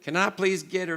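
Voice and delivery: put-on American accent. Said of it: "You put the accent on".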